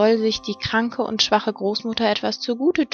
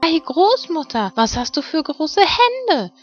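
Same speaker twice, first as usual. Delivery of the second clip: put-on very high little-girl astonishment; growing wonder, high and wide-eyed; amazed Rotkäppchen; 10 semitones higher, 4 dB louder than usual. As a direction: marvel in a high, stretched child's voice. Rotkäppchen grows more amazed.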